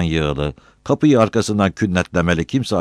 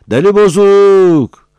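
long, high, drawn-out holler; full-voiced high taunting call; shouting; 12 dB louder, much slower than usual. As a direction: yell it slowly across a distance. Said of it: "buraya bağırıyordu habire".

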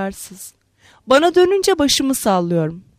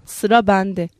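plain reading voice

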